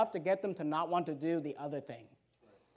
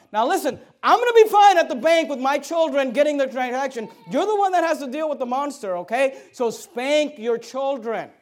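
loud, raised, insistent preacher's voice; preaching at full force; 4 semitones higher, 10 dB louder than usual. Real, not acted. Drive it home loudly to the congregation.

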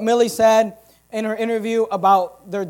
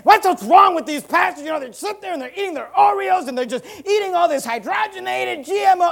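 high, hammed-up tirade; mock outraged rant; 7 semitones higher than usual. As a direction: imitate a man going off in indignation. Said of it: "he started going off".

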